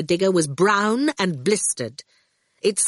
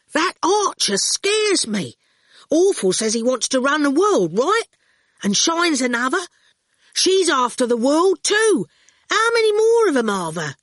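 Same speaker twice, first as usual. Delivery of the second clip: high, raised, insistent voice; cheeky bargainer; 9 semitones higher, 4 dB louder than usual. A narrator's reading. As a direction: argue the case, pressing questions. Ginger's character voice.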